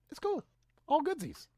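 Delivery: mock-breezy, pitched very high; airy sarcasm, way up high; sarcastic high voice. Repeats sarcastic dismissal.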